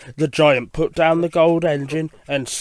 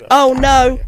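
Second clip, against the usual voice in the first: high, loud cry of dismay; dismayed storybook outburst; alarmed exclamation; 10 semitones higher, 7 dB louder than usual. Acted. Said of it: "Thomas exclaimed".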